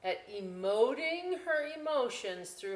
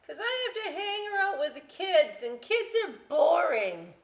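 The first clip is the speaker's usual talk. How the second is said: much higher, louder potato voice; the potato complains about kids; 11 semitones higher, 8 dB louder than usual. Acted.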